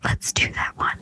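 hushed conspiratorial whisper. Real, not acted. Host whispering her favorite flavor back. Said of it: "Oh, whispering".

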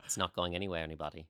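soft put-on Australian accent. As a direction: reassure listeners in a playful, soft Australian accent. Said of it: "which is my Australian accent".

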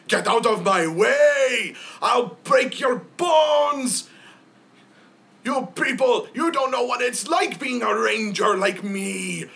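high, loud raging bellow; a brawler screaming in high, loud fury; furious shout. Acted. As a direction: roar at the whole tavern. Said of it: "you hear this voice say".

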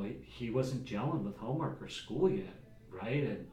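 ordinary talk, nothing marked

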